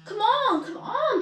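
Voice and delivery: high, loud urging; a locomotive urging its coaches along; puffing engine. A narrator's reading. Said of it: "puffed Gordon to the coaches".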